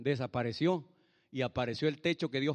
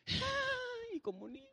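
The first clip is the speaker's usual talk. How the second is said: high, soft mock wail; hammed-up childlike sob; imitated child crying; 6 dB quieter, much slower than usual. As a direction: whimper like a little child. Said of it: "Empecé a llorar como niño".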